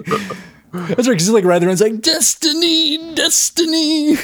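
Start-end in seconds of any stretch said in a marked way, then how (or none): 2.0-4.2 slow eerie high moan; ghostly drawn-out call. Retold or imitated.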